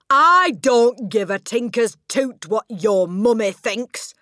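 slow, loud, high roaring yell; furious yell. Acted. Headmistress yells at the girl about her mother. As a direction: yell it with utter contempt. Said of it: "the Trunchbull yelled".

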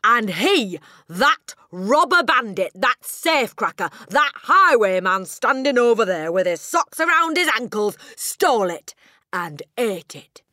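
slow, very loud, outraged accusation; shouting tirade. A narrator's reading. Headmistress accusing the boy of stealing her cake, shouting. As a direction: thunder it out word by word, high and indignant. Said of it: "the Trunchbull shouted".

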